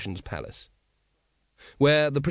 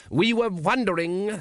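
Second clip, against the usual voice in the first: loudly; 8 semitones higher, slower than usual. A narrator's reading. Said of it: "said the patrician loudly".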